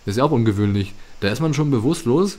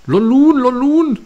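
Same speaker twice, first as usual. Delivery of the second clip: much higher, loud squeaky creature call; creature cries; 10 dB louder, slower than usual. Acted. Enemy Pokémon calling out at the hero, squeaky cries. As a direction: chirp it like a small angry creature.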